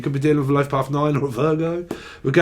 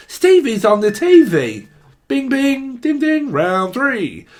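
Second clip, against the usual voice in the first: mock ring announcer; 8 semitones higher, 4 dB louder, slower than usual. Mock boxing bell announcement.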